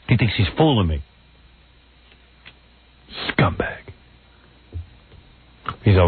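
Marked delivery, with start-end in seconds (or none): 3.3-3.8 a bitter, whispered aside; whispered insult. Real, not acted. Host whispers an insult after the caller leaves, under his breath.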